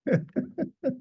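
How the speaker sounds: high burst of delighted laughter; real laugh. Real, not acted. Teacher laughing at his own story's punchline, a high delighted burst. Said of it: "It's great".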